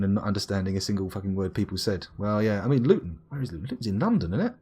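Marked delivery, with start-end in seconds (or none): none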